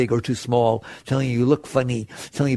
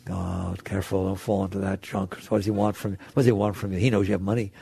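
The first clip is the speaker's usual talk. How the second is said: low, hushed warning to oneself; acted cautious mind-chatter, soft and low; wary inner voice; 7 semitones lower, 6 dB quieter, faster than usual. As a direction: mutter suspicions under your breath. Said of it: "your mind starts going".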